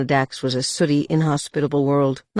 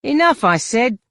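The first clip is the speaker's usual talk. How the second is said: stern command; 9 semitones higher, 7 dB louder than usual. Acted. Bouncer stops a fight.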